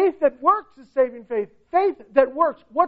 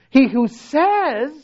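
"says" slow, long and emphatic; stress on SAYS; 4 semitones higher, 3 dB louder, much slower than usual. Shown as a demonstration. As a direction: draw out the word "says". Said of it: "notice this word".

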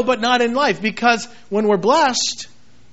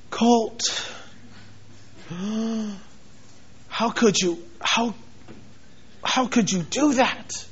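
soft, hushed appalled voice; mock horror; 8 dB quieter than usual. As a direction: act scandalised, hushed. Mimicking shocked outsiders.

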